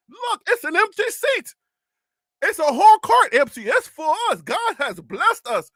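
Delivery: high-pitched, gushing mock tourist; excited tourist. Tourist telling his wife about an empty seat, mock excitement. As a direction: exclaim like an overjoyed naive visitor. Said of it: "You're like".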